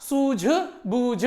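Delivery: सूझबूझ said very slowly, each syllable drawn out; careful spelling-out. Shown as a demonstration. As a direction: stretch सूझबूझ out slowly so every sound is clear. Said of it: "सूझबूझ नहीं होता". (सूझबूझ को बहुत धीरे, एक-एक ध्वनि साफ़ बोलें)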